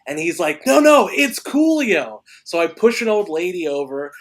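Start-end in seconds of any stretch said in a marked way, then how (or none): 0.7-2.2 loud, high, drawn-out excited protest; re-enacted excited correction; urgent insistence. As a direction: insist on the big news, raised voice. Camera guy insists it's a star.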